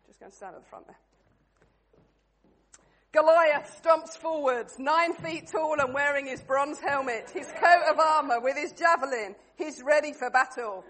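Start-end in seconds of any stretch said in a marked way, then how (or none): 3.1-10.8 loud, projected narrator opening a battle tale; story narration. A narrator's reading. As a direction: narrate grandly to a crowd, slowing at line ends. Narrates the play to the room.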